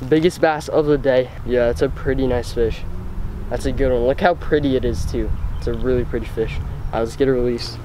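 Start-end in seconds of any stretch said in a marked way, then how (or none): none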